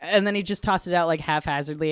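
ordinary talk

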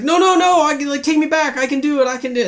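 very high, frantic mock begging; imitated freak-out. Boy sent back by the wizard, imitated frantic freak-out. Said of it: "And that's where he freaks out".

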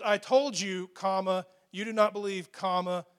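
"comma" spoken aloud as punctuation; reading the commas aloud. Shown as a demonstration. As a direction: pace out each comma as a word. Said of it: "You see, he says".

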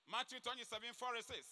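ordinary talk

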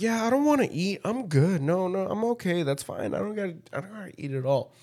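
high, put-on mock polite refusal; Rob. Friend refusing food around women, mock high voice. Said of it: "this would be like".

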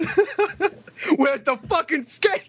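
high-pitched, indignant demand; mock pissed-off customer. Customer sends a carhop back for skates, mock pissed off. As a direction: rant in hammed-up outrage. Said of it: "I'm so pissed about".

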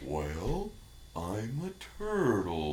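slow sleepy cartoon turtle. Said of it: "turtles are always like".

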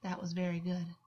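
ordinary delivery, nothing marked

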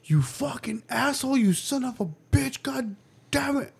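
high-pitched imitation of a swearing child; cursing kid. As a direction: mimic a kid cussing out siblings, voice up high. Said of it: "I just start hearing".

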